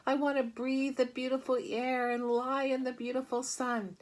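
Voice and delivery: high, tearful pleading pig voice. Acted. Wilbur sobs to Charlotte about wanting to live. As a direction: wail it through tears. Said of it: "Wilbur burst into tears".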